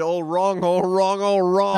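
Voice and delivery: imitated line, paced too slowly; slow quote delivery. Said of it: "Just a little bit too slow".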